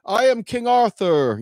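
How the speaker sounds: lightly voiced king, slow and proud; a hint of a pompous king; royal announcement. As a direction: announce yourself grandly, slowly. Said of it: "He's like".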